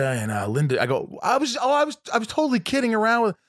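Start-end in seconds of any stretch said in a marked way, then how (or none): 1.2-3.4 high-pitched, retold hasty protest; flustered backpedalling. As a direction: backpedal in a much higher voice. Retold flustered apology on phone.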